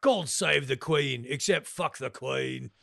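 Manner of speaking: slow, hammed-up Cockney declaration; mock Cockney. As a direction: proclaim it in a put-on Cockney accent. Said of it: "he's got the Cockney accent".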